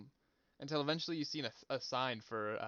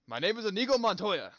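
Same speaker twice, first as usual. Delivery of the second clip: booming theatrical shout, much louder and higher; 8 semitones higher, 10 dB louder than usual. Acted. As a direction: bellow the famous line like a stage actor. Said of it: "in a loud, booming voice".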